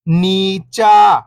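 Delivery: whole word, level then falling at the end; syllables blended. Shown as a demonstration. Teacher showing beginners the joined word, syllables blended. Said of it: "इनको आपस में लाके पढ़ना है".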